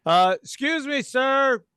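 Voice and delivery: firm cop asking him to roll down the window; police officer. Pretend.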